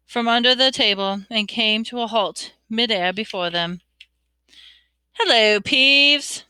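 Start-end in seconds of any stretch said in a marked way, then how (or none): 5.1-6.4 slow, drawn-out wary greeting; cautious. A narrator's reading. Harry greeting a poltergeist, cautious. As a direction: greet him warily, louder and higher. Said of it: "said Harry cautiously".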